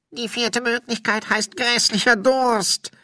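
high, loud put-on spirit voice; thirsty ghost. Spirit hints at wanting drink.

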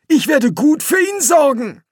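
put-on a fussy old explorer; old Quirm. A narrator's reading. Quirm promises. (viel höhere Greisenstimme)

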